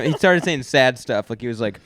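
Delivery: ordinary talk, nothing marked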